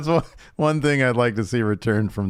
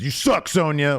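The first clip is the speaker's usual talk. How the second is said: rude fan heckle; 6 semitones higher than usual. Retold or imitated. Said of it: "one of the fans".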